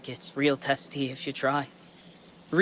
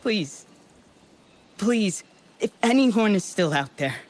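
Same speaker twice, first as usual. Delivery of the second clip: higher pitched, pleading into the void; desperate plea; 4 semitones higher than usual. Acted. Lone survivor begging an unknown listener for rescue, desperate plea. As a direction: beg anyone out there to answer. Begging anyone to answer his broadcast.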